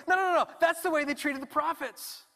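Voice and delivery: Jesus as eager preacher, high and loud; excited insistence. Acted. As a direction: bubble over correcting him.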